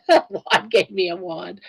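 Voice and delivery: amused laughter. Real, not acted. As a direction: repeat the wordplay with a laugh. Amused repeat of the wordplay.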